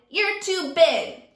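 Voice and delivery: slow, loud jeer; bully shout. Acted. Teacher as Sammy, loudly jeering at Kevin. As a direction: draw it out to mock him. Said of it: "Sammy shouted".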